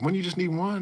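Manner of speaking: high mock question; lightly hammed-up disbelief. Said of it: "I was like".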